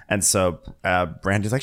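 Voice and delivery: ordinary talk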